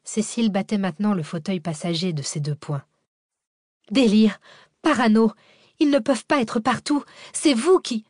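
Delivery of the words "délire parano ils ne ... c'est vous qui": loud, high, agitated accusations; furious outburst. A narrator's reading. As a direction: hurl it at the driver, pitch climbing. Cécile furieuse bat le fauteuil. (accusations furieuses, fortes et aiguës)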